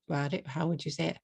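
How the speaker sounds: ordinary talk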